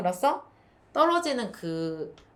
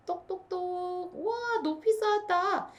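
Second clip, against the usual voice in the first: much higher, slow wondering cheer; delighted praise; 10 semitones higher, slower than usual. Shown as a demonstration. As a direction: marvel at the tall tower. (탑 쌓은 아이에게 높은 목소리로 감탄)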